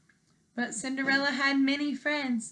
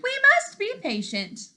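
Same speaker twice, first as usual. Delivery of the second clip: much higher, pleading squeak; nervous duke; 14 semitones higher, 6 dB louder than usual. Acted. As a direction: plead timidly like a nervous courtier.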